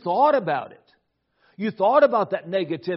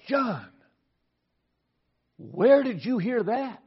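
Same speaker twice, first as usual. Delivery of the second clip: slow, raised-pitch fatherly reprimand; stern raised voice; 5 semitones higher, slower than usual. Retold or imitated. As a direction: question him slowly in a stern father's tone. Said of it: "raised my voice in a certain tone".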